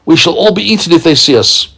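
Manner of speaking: high, fast fretful voice; nervous little earthworm; lightly voiced anxious earthworm; worried earthworm. A narrator's reading. Earthworm character.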